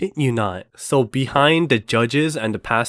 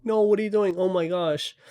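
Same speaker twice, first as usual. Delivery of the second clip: high-pitched retold panicky protest; mock panic; 6 semitones higher than usual. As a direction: act out alarm at being aimed at. Acted panic at pointed gun.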